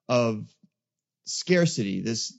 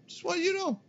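much higher, soft, playful singsong; squeaky high voice; 5 dB quieter, faster than usual. Pretend. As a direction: squeak it out teasingly. Repeats line in squeaky voice.

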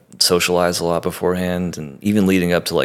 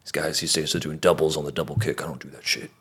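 imitating Trim; 2 semitones lower, 8 dB quieter than usual. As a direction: mutter it like a gruff drummer. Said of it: "he's like".

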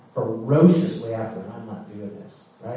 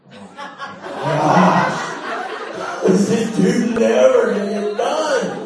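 laughing, high and loud, imitating exasperated friends; two friends laughing at an endless confession; mock exasperated laugh; 6 semitones higher, 7 dB louder, slower than usual. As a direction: play the worn-out friends cracking up in disbelief. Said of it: "the pastor and Dave are like".

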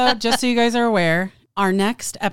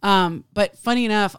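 usual speaking voice

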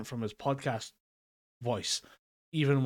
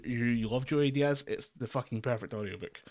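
ordinary talk

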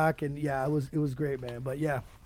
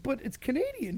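mock offended, high and softer; 9 semitones higher, 5 dB quieter than usual. Offended Swede protesting to the Canadian drinker, mock affronted. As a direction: lightly imitate an affronted protest, pitched up. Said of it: "the dude's all offended".

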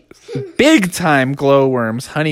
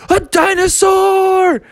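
high startled cry of fright; spooked cry; slower than usual. Acted. Spooked bear shrieking at the roaring T-Rex, high frightened yelp. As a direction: yelp it out, scared stiff. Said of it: "he got so spooked".

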